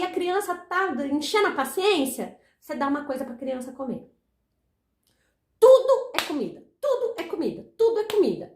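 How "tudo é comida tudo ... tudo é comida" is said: "Tudo é comida" hammered high, TUDO stressed each time; "tudo" pitched high, falling through "é comida"; emphatic repetition. Acted. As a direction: drive home "Tudo é comida" with a high punch on TUDO. Hammering a repeated rule.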